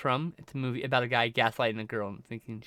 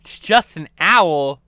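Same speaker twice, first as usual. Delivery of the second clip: surprised; 11 dB louder, slower than usual. Real, not acted. Mock outrage at owl.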